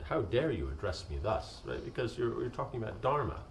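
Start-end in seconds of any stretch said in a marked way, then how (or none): none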